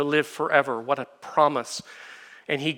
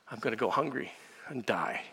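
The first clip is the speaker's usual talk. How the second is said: mock weeping; 5 semitones lower, 11 dB quieter than usual. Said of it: "I start to weep".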